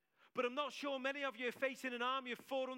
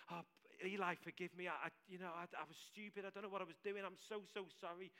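humble apology; 4 semitones lower, 7 dB quieter, faster than usual. Retold or imitated. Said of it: "I might go about it that way".

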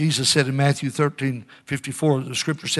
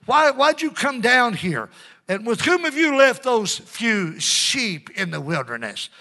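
slow, mocking older brother, pitched higher; sarcastic; 7 semitones higher, slower than usual. Eliab sneering at David, slow and sarcastic. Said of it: "Do you hear the sarcasm in that?".

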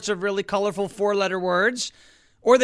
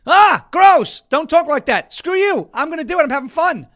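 higher, louder mock-disgusted banter; playful outburst; 7 semitones higher, 8 dB louder than usual. Real, not acted. Host egging himself on after a crude joke. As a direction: squeal in glee at your own joke.

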